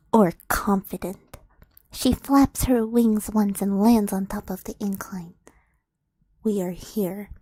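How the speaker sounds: hushed, whispered scene setting; breathy storyteller hush; story narration. A narrator's reading. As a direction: draw them into the dark clearing, low and secret.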